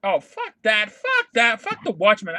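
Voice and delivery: loud, angry rejection; real worked-up outburst. Real, not acted. Comics fan rejects the Watchmen crossover, really worked up. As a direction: explode in loud, indignant refusal. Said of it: "I'm getting worked up".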